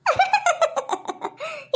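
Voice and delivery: a sudden high, quiet giggle; a squeaky, soft mocking giggle; high laugh. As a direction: laugh at the old woman's expense. Teasing the old woman.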